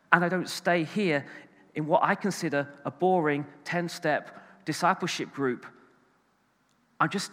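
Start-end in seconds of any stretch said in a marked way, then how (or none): none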